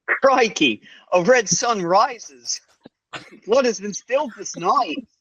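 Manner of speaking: put-on high, drawn-out Australian accent; hammed-up Aussie, pitched well up. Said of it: "Year two with an Australian accent".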